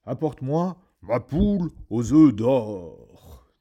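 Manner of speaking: slow, very loud booming command; demanding giant. A narrator's reading. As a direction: bellow the order at the wife. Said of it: "dit-il".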